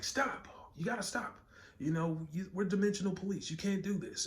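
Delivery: squeaky high-pitched policeman shouting stop; high mock cop voice. Policeman ordering the Hulk to stop, high mock voice. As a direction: play the dimensional cop, voice way up. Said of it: "and he's like".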